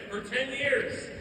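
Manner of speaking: ordinary talk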